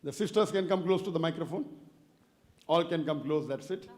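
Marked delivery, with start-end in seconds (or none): none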